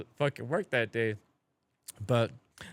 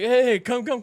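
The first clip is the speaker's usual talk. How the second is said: loud excited call, much higher; calling friends over; 11 semitones higher, 9 dB louder than usual. Retold or imitated. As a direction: shout for his friends to come over. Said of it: "He's like".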